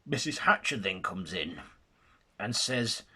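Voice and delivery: ordinary delivery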